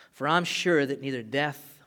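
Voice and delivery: ordinary talk, nothing marked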